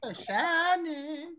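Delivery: a slow, sing-song mock admiration; drawn-out tease. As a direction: stretch shining out slowly and high. Teasing about profile picture.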